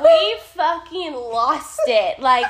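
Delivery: loud, laughing retelling; laughing hard. Real, not acted. Storyteller recalling the trailer losing it, loud and laughing. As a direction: belt it out through the laughter.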